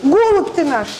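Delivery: high, excited exclamations; изумлённый восторг. Real, not acted. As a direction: cry out in real amazement. Watching crocodiles being fed. (восторженные возгласы изумления)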